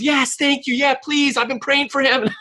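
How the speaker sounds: high, sweet grateful mother; mother thanking a street preacher, high and sweet; grateful mom. Retold or imitated. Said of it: "his mom goes".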